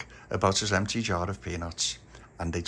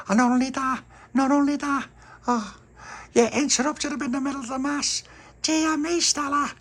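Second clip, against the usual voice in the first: high, loud telling off; exasperated girl; 5 dB louder than usual. A narrator's reading. Pauline telling Stella off, high and exasperated. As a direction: scold her in a high voice.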